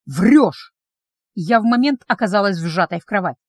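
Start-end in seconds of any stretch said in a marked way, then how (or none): 0.1-0.7 accusing. Acted. Vampire accuses her of lying.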